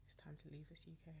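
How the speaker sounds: quick, voiceless whisper; hushed, hurried joke aside. Pretend. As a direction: whisper it like a secret plan. Joking aside after gloomy bills talk.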